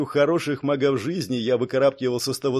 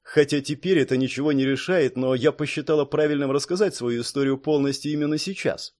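ordinary delivery, nothing marked

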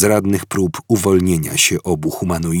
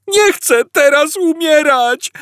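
high, slow whining wail; Arthur wailing; 5 dB louder than usual. A narrator's reading. Arthur to the guard, a high slow wail. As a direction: wail like a frightened man. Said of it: "zawył Artur".

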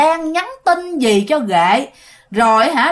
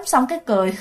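plain reading voice

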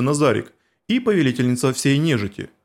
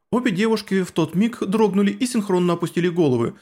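plain reading voice

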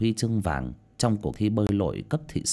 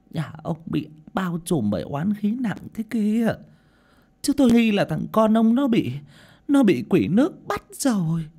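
put-on high-pitched elderly seer; old village seer's ominous pronouncement; old woman medium, grave warning; 12 semitones higher than usual. A narrator's reading. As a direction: warn the family of the evil, haltingly.

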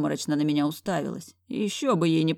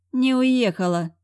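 higher-pitched, drawn-out smug reply; lightly acted smug retort; wry snort; 7 semitones higher, 5 dB louder, slower than usual. A narrator's reading. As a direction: confirm it slowly with amused defiance. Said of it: "хмыкнула подруга".

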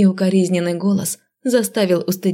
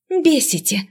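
high-pitched exasperated jab; heartfelt hiss; 9 semitones higher than usual. A narrator's reading. Heroine fed up with the lord's teasing, exasperated hiss. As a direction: spit it out with heartfelt annoyance. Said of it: "прошипела я от всего сердца".

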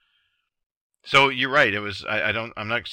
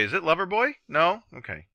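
hopeful guess; 8 semitones higher than usual. Retold or imitated. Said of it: "I just say".

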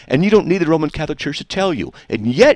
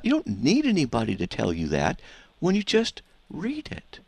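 ordinary delivery

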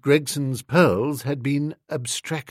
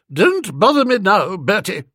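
sharp, louder snap at Bertie; aunt snapping at her nephew to go away; snapped; 6 semitones higher, 5 dB louder than usual. A narrator's reading. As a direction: brush him off sharply. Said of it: "she snapped".

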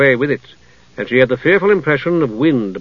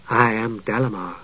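soft, lightly voiced seductive elf; a touch of sweet seduction; a hint of seductive; 3 semitones lower, 6 dB quieter than usual. A narrator's reading. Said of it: "sweet and clear and seductive".

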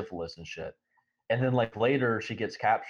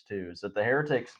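ordinary talk

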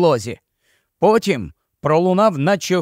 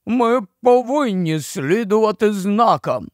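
gloomy marsh-wiggle; 6 semitones higher than usual. A narrator's reading.

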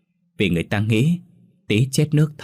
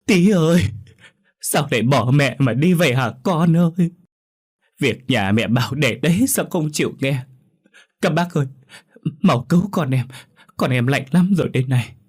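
high, loud grief-stricken wail; 7 semitones higher, 4 dB louder than usual. Acted. Stepmother clutching the body and begging villagers, wailing. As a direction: cry out to the dead child, voice raised high. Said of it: "mà kêu gào".

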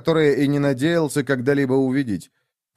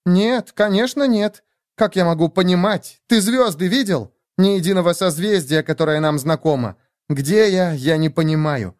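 put-on higher-pitched, exasperated young woman; agitated Nastya; 6 semitones higher than usual. Nastya tells Lev she is lost, agitated. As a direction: protest in a raised, emphatic voice.

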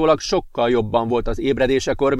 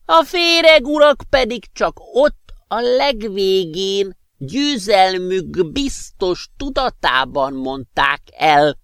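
magas, lassú, recsegő öreg hang; krákogva vigasztalja Ezvint; magas krákogás; 10 semitones higher, slower than usual. Acted. Professor's high voice held. (magas hangon krákogó öreg professzor)

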